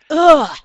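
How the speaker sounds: loud, high fed-up ugh; exasperated groan. Real, not acted. Teller fed up with hearing about Thor, loud groan.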